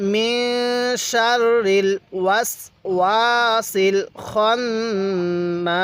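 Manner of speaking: long hummed nun in "মিন" and "খন্নাস", drawn-out vowels; slow measured reciting, stretch "ওয়াসওয়াসিল" and "খন্নাস"; slow model recitation. Shown as a demonstration. Teacher leading learners to read along, slow drawn-out "মিন শাররিল ওয়াসওয়াসিল খন্নাস". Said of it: "এরকমভাবে শিখব".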